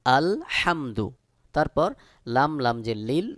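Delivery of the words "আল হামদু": slow আল হামদু, h from the middle of the throat; correct throaty ha. Shown as a demonstration. Teacher repeats the correct form, slow mid-throat h in আল হামদু. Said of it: "সহি উচ্চারণ হলো".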